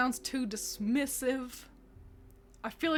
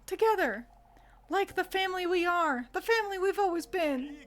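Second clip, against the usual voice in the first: higher, louder earnest appeal; earnest Freya; 7 semitones higher, 3 dB louder than usual. Acted. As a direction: plead with father for unity. Freya speaks to father, village.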